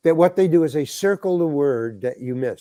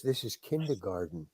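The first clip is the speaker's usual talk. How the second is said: low, soft undertone; quiet private thought; 8 semitones lower, 14 dB quieter than usual. Retold or imitated. Actor realizing he is treated like a child, quiet private thought. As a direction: drop low, as if thinking aloud.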